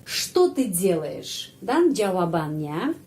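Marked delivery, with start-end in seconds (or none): 0.0-1.5 что ты делаешь slow and clear, что stressed; teacher modelling что ты делаешь; slow careful question. Shown as a demonstration. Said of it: "what are you doing".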